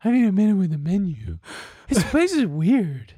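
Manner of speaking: high, airy put-on voice of a bored date; imitating his date's voice. Guy imitating his dinner date at a Mexican restaurant, high mocking voice. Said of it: "Not only is this exactly how she sounds".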